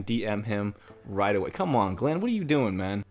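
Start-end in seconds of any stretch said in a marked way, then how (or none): none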